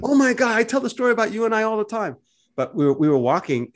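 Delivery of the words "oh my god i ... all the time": much higher, louder, gushing oh my God; excited reunion. Retold or imitated. Rob greeting his old travel companion, excited and much higher. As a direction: burst out in delighted recognition. Running into old friend again.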